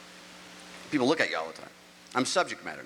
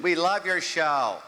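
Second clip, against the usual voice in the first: slow, higher gushing fan voice; 5 semitones higher, 3 dB louder, much slower than usual. Retold or imitated. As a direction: fans gushing at the pharmacy. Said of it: "some lady, kid, and her husband".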